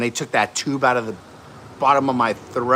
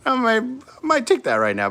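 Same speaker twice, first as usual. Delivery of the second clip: eager reply; 14 semitones higher, 4 dB louder than usual. Retold or imitated. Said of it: "I'm like".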